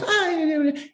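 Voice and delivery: very high squeaky wife voice; the wife brushing it off, high; imitating wife. Wife voiced in funny story.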